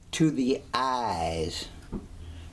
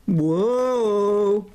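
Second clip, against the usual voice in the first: mock spooky whoa; 7 semitones higher, 5 dB louder than usual. Spooky reveal, exclamation.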